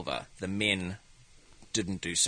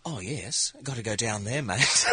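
New Zealand accent. Shown as a demonstration. Comedian demos a New Zealander entering a room, mild Kiwi accent. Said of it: "here's a New Zealander".

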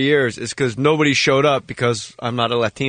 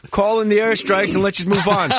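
army general; 6 semitones higher than usual. Retold or imitated. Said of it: "like a general in the field".

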